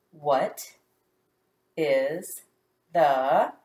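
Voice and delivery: sounding out a sentence slowly; word-by-word reading. Shown as a demonstration. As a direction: read each word alone, with long gaps. Reading word by word while decoding.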